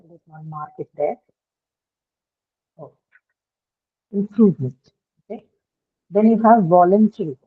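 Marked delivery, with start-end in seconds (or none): 4.1-4.9 "Improvement" with the middle syllable "prove" stressed; stress on second syllable. Shown as a demonstration. Teacher modelling a word for the class, second-syllable stress in "Improvement". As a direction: lean on "prove" in "Improvement", falling after it. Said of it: "Improvement was on the second syllable".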